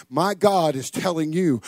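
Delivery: ordinary talk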